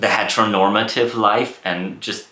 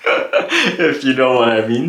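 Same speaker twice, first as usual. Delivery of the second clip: laughing; 5 semitones higher, 4 dB louder than usual. Real, not acted. Laughs at her niche.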